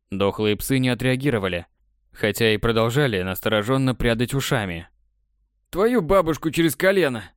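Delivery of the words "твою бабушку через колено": Patogenych exclaiming. Acted. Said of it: "проговорил Патогеныч".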